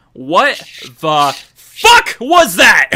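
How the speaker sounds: hammed-up stunned yell; shocked outburst. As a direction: spell out your disbelief slowly, then erupt. Acts out shocked messages after inhale.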